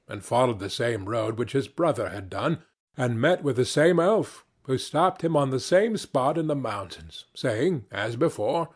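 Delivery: ordinary delivery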